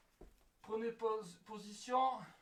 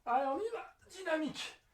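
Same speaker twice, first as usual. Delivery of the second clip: energetic coaching call; 7 semitones higher than usual. Real, not acted. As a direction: pump up the class. Coach urging the class.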